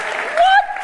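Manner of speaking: a very high, stunned "What?"; shocked what. Real, not acted. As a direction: leap up in pitch in disbelief. Shocked at test result.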